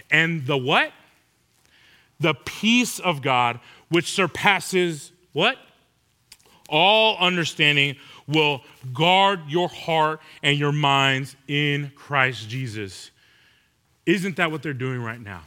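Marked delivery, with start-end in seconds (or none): 2.2-13.1 slow, low, drawn-out recitation; slow scripture reading. Acted. Preacher reciting Philippians to the congregation, slow and low. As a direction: savor every word of the verse.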